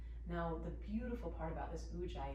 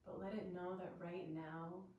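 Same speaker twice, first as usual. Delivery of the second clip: soft meditation voice with long pauses; guided meditation; 6 dB quieter than usual. Real, not acted. Yoga teacher guiding students to observe their breath, soft meditative voice. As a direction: gently settle them into stillness, leaving space between phrases.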